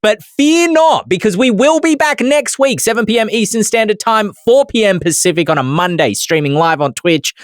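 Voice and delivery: announcer voice. Acted. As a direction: boom it out like a host. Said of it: "sign-off announcement".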